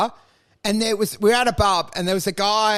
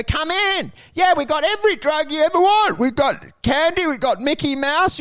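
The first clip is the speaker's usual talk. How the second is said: lightly voiced higher-pitched luring stranger; coaxing stranger; 5 semitones higher than usual. Man luring them into the van, mock coaxing. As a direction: imitate an eager sales pitch from the van. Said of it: "when they first go".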